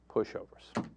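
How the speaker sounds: a soft whispered insult under the breath; whispered aside. Real, not acted. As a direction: breathe the word out in disgust. Muttered insult after sighs.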